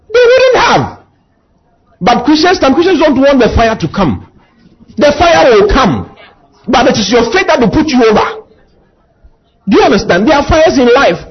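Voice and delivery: high, driving preacher voice; preaching at full force. Acted. Preacher urging believers not to fear the fire. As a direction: fire up the church.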